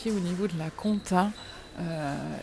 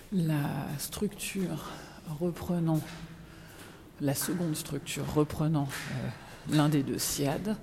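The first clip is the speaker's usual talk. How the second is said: dictating. Real, not acted. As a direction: dictate an email word by word. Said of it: "je peux plus dicter".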